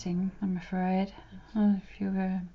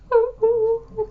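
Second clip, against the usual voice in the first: excited cheer; 11 dB louder than usual. Real, not acted. Cheering about her birthday week.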